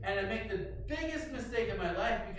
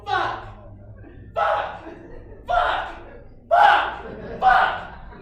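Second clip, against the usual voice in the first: each curse much higher and much louder, some half-breathed; panicked yelling; 13 semitones higher, 14 dB louder, much slower than usual. Retold or imitated. Man bending his cut finger, yelling curses in panic. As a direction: bark out the curses in pain and shock. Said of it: "And I go".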